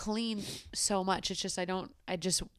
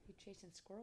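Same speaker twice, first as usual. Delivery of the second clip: soft pet talk; 28 dB quieter than usual. Real, not acted. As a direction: coo softly so she doesn't wake. Talking to sleeping dog.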